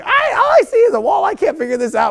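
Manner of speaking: mock-helpless planet staring at a wall; baffled Mercury. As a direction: squeak it out like a lost little planet. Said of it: "Mercury's like".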